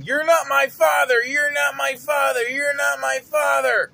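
high, loud mock shout; yelling. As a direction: scream it like a raging kid.

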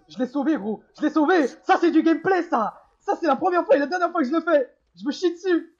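loud, high triumphant bragging; excited bragging. Real, not acted. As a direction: crow about the rescue. Triumphant after a rescue.

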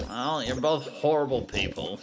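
ordinary talk, nothing marked

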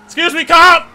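high, very loud yell at the cop; frantic shout. Real, not acted. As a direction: shout them out of the way in panic. Yelling at pursuing cop in game.

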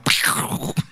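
imitated explosion, breathy burst; quiet voiceless "pkhhh" explosion; mock explosion noise. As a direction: make a blast sound with the mouth. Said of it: "let's just have an explosion".

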